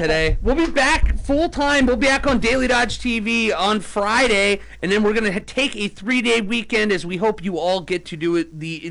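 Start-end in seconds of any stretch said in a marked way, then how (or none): 0.4-6.4 slow, broadcast sign-off voice; a light touch of announcer sign-off; radio host sign-off. Acted. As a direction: close the show like a radio host.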